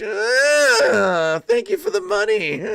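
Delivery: high, hammed-up greedy voice; mock gloating. Streamer pretending greed over a donation, high mock gloating.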